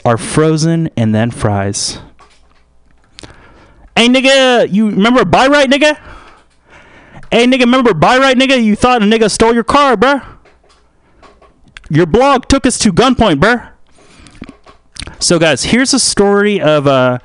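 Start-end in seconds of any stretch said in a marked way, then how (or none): 3.9-13.7 high, fast, pushy heckler voice; excitable high put-on street voice; taunting heckler. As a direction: rattle off taunts in a pitched-up voice. Plays the heckler again.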